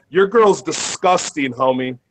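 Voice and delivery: ordinary delivery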